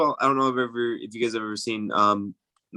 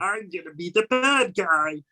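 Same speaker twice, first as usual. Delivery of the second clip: higher, lightly put-on nerd voice; nerdy voice; 7 semitones higher than usual. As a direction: play it as a timid nerd. Joining the Morbius impression.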